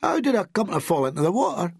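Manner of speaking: high-pitched helpless question; bewildered little-boy appeal; pleading. A narrator's reading. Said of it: "he pleaded".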